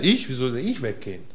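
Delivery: normal talking voice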